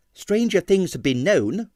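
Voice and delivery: plain reading voice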